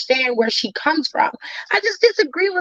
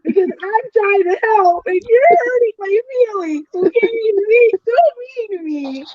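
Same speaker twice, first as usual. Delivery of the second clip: higher, whiny mock-hurt plea; 6 semitones higher than usual. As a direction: play the wounded friend, half crying.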